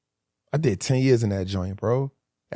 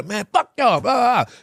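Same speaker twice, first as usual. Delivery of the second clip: higher, defiant brush-off; higher, cocky dismissive defiance; 9 semitones higher than usual. Retold or imitated. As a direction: wave off the guards. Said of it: "He tell".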